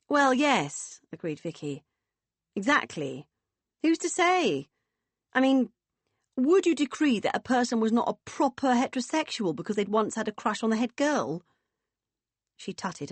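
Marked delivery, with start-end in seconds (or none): 0.1-1.0 high, loud agreement; booming hearty woman. Acted. Vicky agreeing with her friend, hearty. 2.6-11.4 high, hearty and emphatic; booming, opinionated woman. Acted. Woman arguing her point to friends, emphatic.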